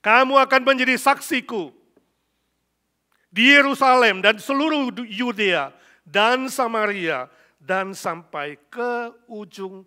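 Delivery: loud, high proclamation of scripture; ringing, shouted sermon delivery; full-force preaching. Real, not acted. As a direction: declare it to the whole hall at full volume.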